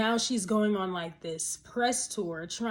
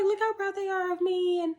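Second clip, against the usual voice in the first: mock quote; 8 semitones higher, 3 dB louder than usual. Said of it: "She's like".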